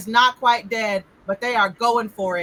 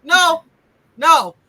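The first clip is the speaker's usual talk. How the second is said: louder, higher firm no; emphatic retold refusal; 5 semitones higher, 5 dB louder than usual. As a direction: refuse firmly, voice raised. Retelling his firm refusal to friend.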